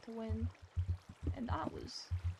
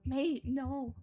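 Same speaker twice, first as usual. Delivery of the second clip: Aussie-style mate; 5 dB louder than usual. Retold or imitated. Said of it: "adopting their words and sayings".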